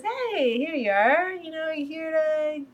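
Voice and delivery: high-pitched acted greeting; Irvin. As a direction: lightly play a chatty man greeting someone. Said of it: "And he goes".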